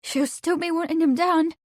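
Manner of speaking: high, tearful plea; half choked. Acted. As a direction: hold back a sob. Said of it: "half choked".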